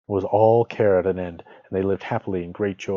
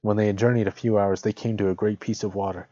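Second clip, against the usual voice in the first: usual speaking voice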